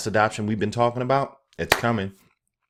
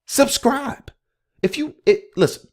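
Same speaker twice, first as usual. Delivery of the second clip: squeaky high plea; 5 dB louder, slower than usual. Acted. Pleading for subscribers.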